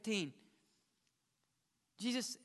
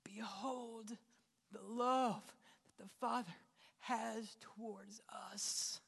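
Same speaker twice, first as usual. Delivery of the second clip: hushed whisper; 6 dB quieter, slower than usual. Acted. Preacher quoting Father's welcome.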